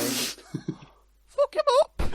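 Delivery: high, slow screaming cheer. Pretend. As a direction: shriek it from the sidelines, laughing.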